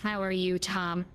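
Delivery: usual speaking voice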